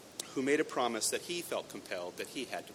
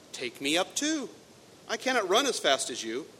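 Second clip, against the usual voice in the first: higher, pleading frog voice; 8 semitones higher, 8 dB louder than usual. Acted. Frog begging the princess to take him, higher pleading. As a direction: beg her to carry you. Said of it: "cried the frog".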